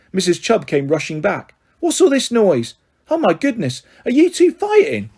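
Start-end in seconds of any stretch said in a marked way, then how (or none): 1.8-5.1 high, startled alarm rising to a shriek. Acted. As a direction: break up the fight, shocked. Foster mother bursts in on fight.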